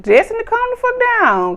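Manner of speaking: high, loud calm the fuck down; retold exasperated yell. Vlogger yelling at Destiny on screen to calm down. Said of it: "Had me like".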